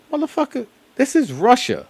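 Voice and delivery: very high, hammed-up outraged yell; mock angry Russian. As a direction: squeal it like an indignant local telling off a foreigner. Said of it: "They're like".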